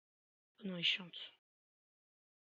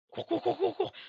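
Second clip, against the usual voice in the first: higher, loud panicked chant of cours; frantic yell; 8 semitones higher, 12 dB louder than usual. Real, not acted. Player running from the monster, frantic shout. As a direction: urge yourself on in terror.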